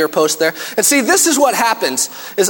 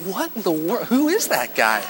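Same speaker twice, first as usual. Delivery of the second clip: stunned under breath; 6 dB quieter than usual. Retold or imitated. Kid watching dad pray, stunned and soft. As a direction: mutter it in disbelief. Child's stunned inner reaction.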